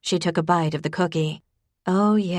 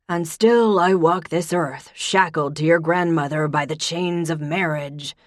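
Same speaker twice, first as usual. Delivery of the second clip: mock-tragic grandpa; 3 dB louder than usual. A narrator's reading. Pop's mock lament.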